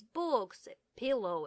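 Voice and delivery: usual speaking voice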